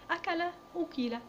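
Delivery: أكل first as akala, then ukila; teacher contrasts أكل akala and ukila; active then passive. Shown as a demonstration. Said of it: "بضم أوله وكسر ما قبل آخره".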